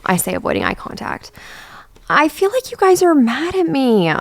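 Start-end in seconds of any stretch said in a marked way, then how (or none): 2.1-4.1 high, slow whine; slow whiny guilt trip at her friends; whining. Acted. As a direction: whine like a hurt child. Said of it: "Tara whines".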